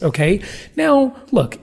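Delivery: ordinary talk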